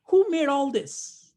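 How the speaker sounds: high, loud, drawn-out wonder; wondering exclamation. Real, not acted. Teacher asking kids who created nature, loud wonder. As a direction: ask it loudly in slow, high-pitched amazement. Rhetorical question to the children in teaching.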